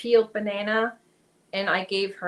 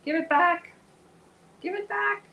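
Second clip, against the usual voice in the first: pet talk; 9 semitones higher, 4 dB louder than usual. Real, not acted. Talking to cat tangled in yarn.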